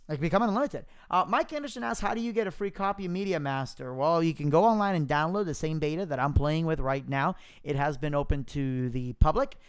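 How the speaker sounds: ordinary delivery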